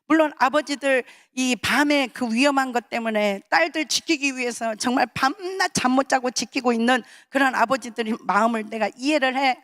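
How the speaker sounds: ordinary talk, nothing marked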